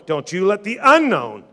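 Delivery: normal talking voice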